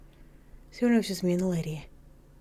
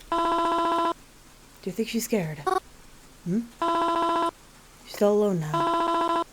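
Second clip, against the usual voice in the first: high, slow mourning mother; a frail mother lingering on every word; high drawn-out grieving mom; 10 semitones higher, much slower than usual. Acted. Mother character speaks.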